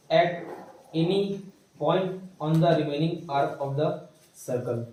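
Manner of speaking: slow, drawn-out dictation with pauses between phrases. Acted. Teacher dictating the English theorem to the class, slowly. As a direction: spell it out phrase by phrase for copying.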